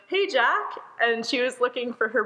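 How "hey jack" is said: high-pitched call, only a little louder; mock yell. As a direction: imitate calling out to someone at the back. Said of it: "and yells out".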